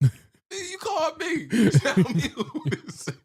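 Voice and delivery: very high, loud protest breaking into laughter; mock outrage. Caller fed up at being asked who it is, mock outrage. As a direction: shout it high in comic exasperation.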